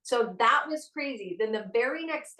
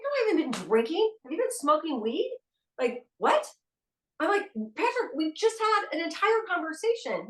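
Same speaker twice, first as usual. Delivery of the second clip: higher, rising in disbelief; baffled mom protesting she hasn't been drinking; exasperated disbelief; 6 semitones higher than usual. Retold or imitated. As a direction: pitch climbs in exasperation. Arguing with son on phone.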